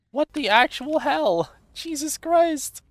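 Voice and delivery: shrieking high and loud. Real, not acted. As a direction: squeal out in disbelief. Frustrated by hard clicks, shrieks.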